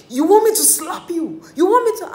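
high, loud mock threat, half laughing; threatening shout. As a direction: yell it like you're about to slap him. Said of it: "and she was like".

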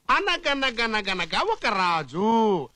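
very slow, stretched-out recital; drawn-out storyteller opening. Acted. Father answering son like a fairy tale, drawn out. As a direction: spin out the tale's first words.

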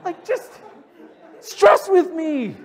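stressed friend laughing back at Josh; laughing exasperation. Retold or imitated. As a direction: plead through laughter. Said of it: "And I'm like".

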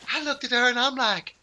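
high-pitched comic retelling; mock bravado building to a punchline; boasting sketch husband.

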